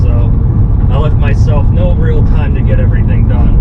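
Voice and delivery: loud, talking over road noise. Real, not acted. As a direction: project over the engine. Talking over engine noise while driving.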